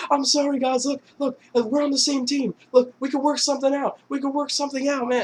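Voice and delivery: high begging voice; pleading scared Rocco. Retold or imitated. Rocco begs the masked men, high frightened pleading. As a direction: plead for your life. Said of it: "And he's like".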